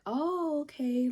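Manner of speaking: high, drawn-out oh-okay; toned-down imitation of aunt. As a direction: stretch it out as a light mock concession. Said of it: "but not like that".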